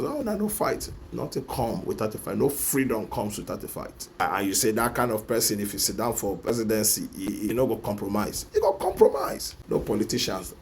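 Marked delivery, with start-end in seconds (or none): 0.0-0.9 squeaky high mocking voice of a supporter; mock timid supporter. Vlogger mocking a timid supporter, high squeaky voice. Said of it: "the support". 8.5-9.5 very high mocking squeal; mock the naive belief with a squeak; sarcastic retort. Vlogger ridiculing voters' faith in politicians, high sarcastic squeal.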